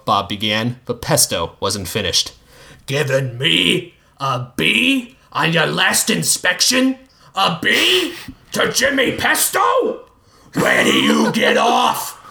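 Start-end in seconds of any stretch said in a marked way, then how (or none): 2.9-12.2 furious rival scolding; angry rant. Acted. Pesto confronts inspector angrily.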